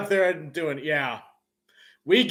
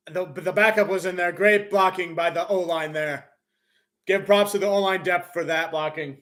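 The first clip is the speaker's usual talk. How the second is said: usual speaking voice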